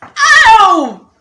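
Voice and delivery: loud, high, falling "ow"; pained cry. Retold or imitated. Girl hearing crush likes her friend, pained wail. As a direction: wail as if struck in the heart.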